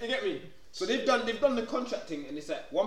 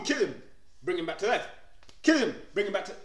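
ordinary delivery

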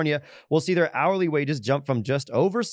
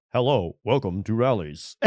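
slow, mechanical ordering-machine greeting; drive-thru robot voice; 3 semitones lower, slower than usual. Retold or imitated. Friend imitates the drive-thru machine greeting customers, slow and robotic. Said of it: "it's like".

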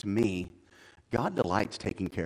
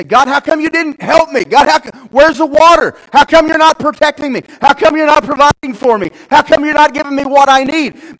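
high, loud demanding questions; shouted complaint; 19 dB louder than usual. Acted. Believer demanding answers from God, shouted complaint. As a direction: rail at heaven, each question hurled.

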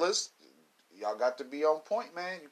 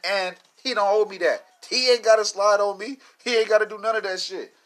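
raised, higher, louder griping; louder and higher, like venting at a friend; heated complaint; 8 semitones higher, 8 dB louder than usual. Real, not acted. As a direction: friend who stopped showing up, raised griping. Rising indignation about his friend.

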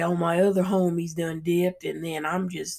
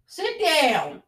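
yelling; 9 semitones higher than usual. Real, not acted. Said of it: "You got me yelling on the podcast".

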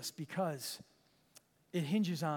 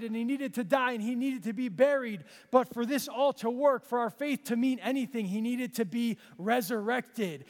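higher and louder, pressing each line home; full-force preaching; 4 semitones higher, 3 dB louder than usual. Real, not acted. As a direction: preach it to the church with rising fire.

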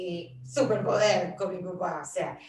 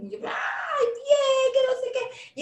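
much higher, excited cry of joy; excited outburst; 6 dB louder, much slower than usual. Retold or imitated. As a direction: squeal with happy excitement. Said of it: "me escucha emocionada por algo".

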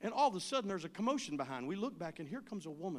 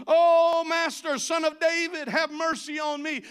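crying out; 8 semitones higher, 13 dB louder than usual. Retold or imitated. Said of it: "he began to cry out".

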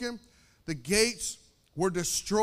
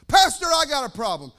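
louder, higher complaining churchgoer; loud mock complainer; 4 semitones higher, 10 dB louder than usual. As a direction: blurt it out as a congregant's complaint. Voices a complaining church member.

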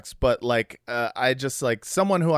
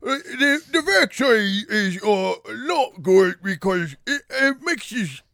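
Russell Brand impression; 9 semitones higher, 4 dB louder than usual. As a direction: ham up a Russell Brand ramble, pitched way up. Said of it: "that's how he talks".

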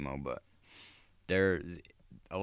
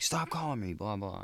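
mock yell; 5 semitones higher than usual. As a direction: toss off a pretend yell. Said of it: "like, fucking yelling".

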